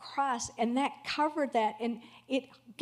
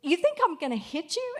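husband, shocked; 7 semitones higher, faster than usual. Retold or imitated. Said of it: "And he said".

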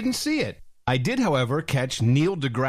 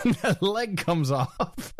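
laughing; 5 semitones higher, slower than usual. Real, not acted. Telling story, laughing.

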